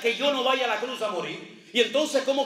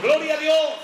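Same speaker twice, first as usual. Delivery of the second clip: Gloria a Dios, called out loud and high; exultant cry of praise; 5 semitones higher, 6 dB louder than usual. Real, not acted. Preacher praising God before the congregation, in a loud exultant cry. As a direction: lift the praise to the whole church.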